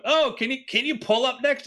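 high-pitched mock pleading; put-on high voice, asking a favour; acted pleading sailor. Sailor asking the other boat to pull up, mock plea.

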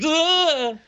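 high, strangled yelp of being zapped; acted electrocution groan; electric shock cry. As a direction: cry out as the shock goes through you. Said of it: "you get a big shock".

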